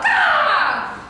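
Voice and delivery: long, loud, high caw; crow caw. Acted. Performer as magnificent crow, loud high cawing. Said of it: "What a magnificent crow".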